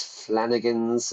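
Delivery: ordinary talk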